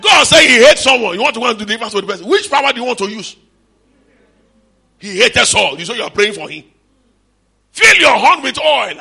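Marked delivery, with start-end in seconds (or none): 0.0-3.4 shouted, high preaching at full force; fiery preaching. Acted. 7.7-9.0 high, loud, slow commanding voice of God. Acted.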